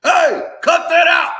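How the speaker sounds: high, loud hammed-up shout at players; over-the-top fake coach yelling; mock coach yell. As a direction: bark it like a wannabe coach showing off his voice. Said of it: "come off the street like".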